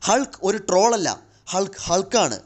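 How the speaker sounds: loud, high-pitched roar of She-Hulk. A narrator's reading. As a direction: bellow it as a battle boast. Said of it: "ഷീ Hulk അലറുന്നുണ്ടായിരുന്നു". (ഉയർന്ന സ്വരത്തിൽ അലറി)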